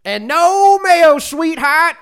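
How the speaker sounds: slow, high, far-off Southern drawl. Retold or imitated. Customer ordering from a waitress, slow Southern drawl. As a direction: draw it out like a good old boy.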